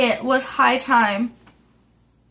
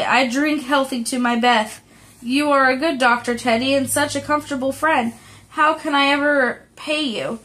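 plain reading voice

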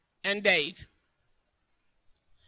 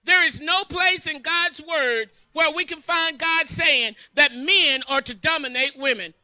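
usual speaking voice

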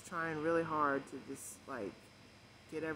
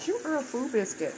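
playful shout; 8 semitones higher, 10 dB louder than usual. Real, not acted. Outtake yelling at dog.

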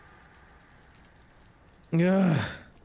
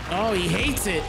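ordinary talk, nothing marked

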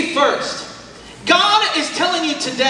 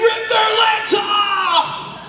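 drawn-out crowd yell, pitched higher; 7 semitones higher, much slower than usual. Retold or imitated. Preacher imitating a crowd roaring at a football game, stretched-out yell. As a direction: bellow it slowly like a roaring stadium. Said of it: "a crowd of people yelling and screaming".

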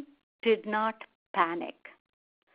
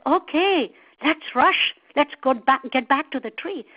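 louder, higher urging; rally someone to hurry; eager, urgent; 5 semitones higher, 6 dB louder than usual. A narrator's reading. Crocodile hurries monkey back to the tree, eager.

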